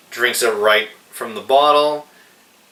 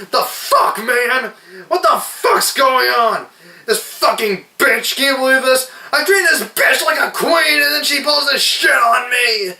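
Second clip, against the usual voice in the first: much higher, shouted rant; imitated furious yelling. Reviewer imitating Bruce Willis raging about his movie wife, high shouted fury. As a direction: blow up like a jilted husband. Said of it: "flips out, flips over a table, yelling".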